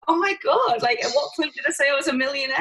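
high-pitched retold protest of disbelief; exasperated. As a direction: protest incredulously, pitch jumping up. Acting out her exasperated reply.

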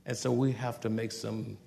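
quiet, firm, with a smile; calm but firm. Retold or imitated. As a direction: parent warning a child softly, calm threat. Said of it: "calm and mean business".

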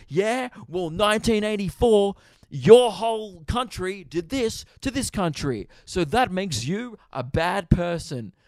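high, loud, self-righteous mock rant; mock accusing commenter. Acting out commenters derailing an argument, mock accusing.

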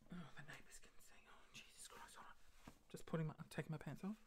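very soft, distracted whisper; under the breath while busy dressing; whispering to self. Real, not acted.

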